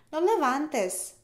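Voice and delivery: ordinary delivery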